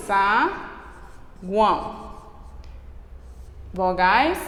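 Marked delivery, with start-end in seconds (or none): 0.1-2.1 Saguão drawn out slowly and softly; slow demo. Shown as a demonstration. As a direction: stretch out Saguão. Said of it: "o exemplo que a gente acabou". (saguão bem devagar e suave)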